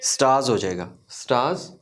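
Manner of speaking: स्टार्स said "staaz", no R; silent R. Shown as a demonstration. Teacher showing R before a consonant, silent R. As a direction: drop the R in स्टार्स, long open vowel. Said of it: "we don't pronounce it".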